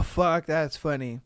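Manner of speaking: usual speaking voice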